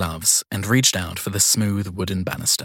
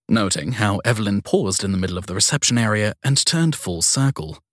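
usual speaking voice

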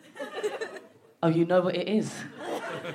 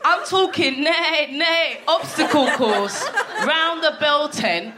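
slow mock neighing; 3 semitones higher, slower than usual. Women pretending to be ponies.

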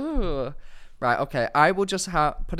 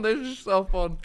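cheeks stuffed full; 3 semitones higher than usual. Real, not acted. Mouth full of marshmallows, laughing.

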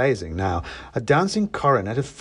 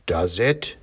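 lower, lightly voiced Santa; Father Christmas; 4 semitones lower than usual. A narrator's reading. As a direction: sceptical Santa, understated. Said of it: "said Father Christmas".

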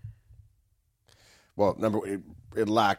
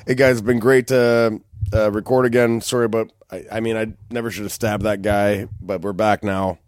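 loud, hammed-up host greeting; a fake apology-laden welcome-back read; mock podcast intro; 6 dB louder than usual. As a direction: play the returning host cheerfully. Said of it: "mock podcast comeback intro".

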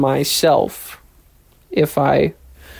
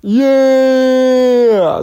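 high, loud, slow gushing; mock fangirl excitement; 9 semitones higher, 3 dB louder, slower than usual. Mocking coworkers named after YouTube brothers, squealing. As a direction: squeal it like a starstruck fan. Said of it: "And I was like".

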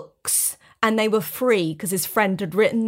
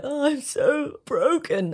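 higher, slow mock-pitiful whine; mocking self-pity; 5 semitones higher, slower than usual. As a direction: mimic his fake wounded act. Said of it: "he was doing the whole".

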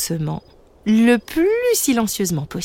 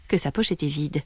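usual speaking voice

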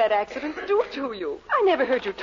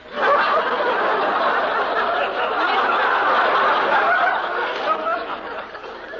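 bursting into a high breathless laugh; loud laugh; 12 semitones higher, 4 dB louder than usual. Acted. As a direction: laugh out loud at the boss's joke. Secretary laughs at boss's joke.

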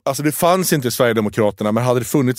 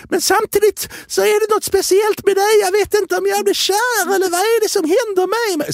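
much higher lovestruck parody; mocking high swoon. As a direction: gush like a smitten cartoon. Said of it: "och sen så gjorde de också". (hög, förälskad parodiröst)

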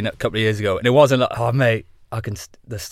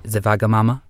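put-on Sven accent at his usual pitch; 4 dB louder than usual. Guest stays in character as his alter ego, put-on accent. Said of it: "putting on the fucking accent".

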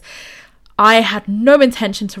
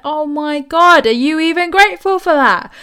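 indignant outrage; 7 semitones higher, 5 dB louder than usual. Retold or imitated. Giver outraged at ungrateful friend, loud indignant cry. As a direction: demand it in disbelief, much higher.

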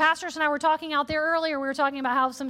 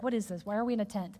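quiet bewildered aside; 6 semitones lower, 7 dB quieter, faster than usual. Retold or imitated. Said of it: "I was like".

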